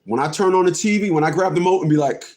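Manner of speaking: ordinary talk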